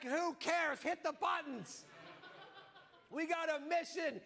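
high, fierce urging to press on; intense mission dad. Retold or imitated. Husband pushing his sick wife to hit the buttons. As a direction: brush her off, all in.

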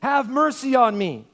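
loud high cry for mercy; calling out. Acted. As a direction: cry out to Jesus from the roadside. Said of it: "He called out".

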